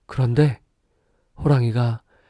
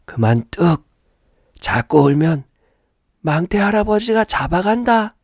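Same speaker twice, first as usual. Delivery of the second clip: stern granny voice rising high; firm grandmother; 9 semitones higher, 4 dB louder than usual. Acted. Grandmother firmly hushing the baby, stern high granny voice. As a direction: scold it like a firm old granny. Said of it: "단호한 목소리로".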